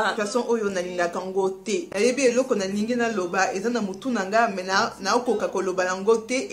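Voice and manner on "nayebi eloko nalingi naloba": usual speaking voice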